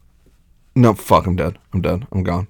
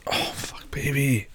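whispering; 5 semitones higher, 6 dB quieter, slower than usual. Acted. Whispered intimate moan.

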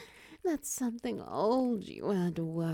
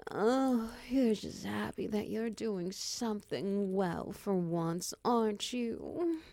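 normal talking voice

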